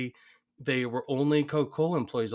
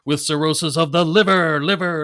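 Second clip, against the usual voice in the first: loud, raised announcer with echoed words; racetrack announcer; 5 semitones higher, 9 dB louder than usual. Retold or imitated. Co-host quoting the benefit race intro, announcer voice. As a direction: project it like a track PA, echoing key words. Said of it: "I love this when he goes".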